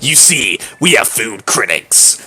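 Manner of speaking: slow, low haughty noblewoman; Miranda voice. Acted. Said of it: "I'm gonna have to voice Miranda".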